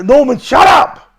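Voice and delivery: loud, slow shout of Norman, shut up; mock guard yelling; imitated guard shout. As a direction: yell it like a guard at the door. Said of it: "they used to come to".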